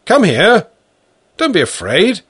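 loud, high coaxing call. Acted. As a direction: call him out gently but loud. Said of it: "I called".

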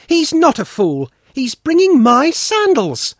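put-on high, loud, delighted little girl; joyful cry. A narrator's reading. Svetlana seeing Sanka bring her sandals, joyful high cry. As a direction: burst out happily, pitched right up. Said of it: "Svetlana cried joyfully".

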